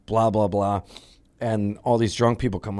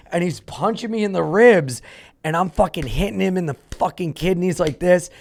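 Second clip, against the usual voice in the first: normal talking voice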